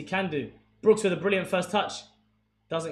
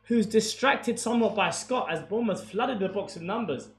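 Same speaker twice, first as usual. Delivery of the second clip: rising, much higher and louder play-by-play on the attack; live commentary; 7 semitones higher, 10 dB louder than usual. Acted. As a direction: call the chance like a TV commentator.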